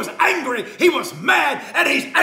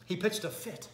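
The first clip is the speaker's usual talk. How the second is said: normal talking voice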